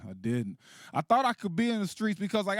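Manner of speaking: ordinary talk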